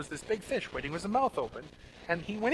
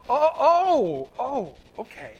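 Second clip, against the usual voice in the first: higher and louder, a mock startled oh, oh, oh, okay; sudden realization; 6 semitones higher, 8 dB louder, much slower than usual. Preacher voicing a listener's sudden realization, mock startled. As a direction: jolt into it like the penny just dropped. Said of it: "for you to go".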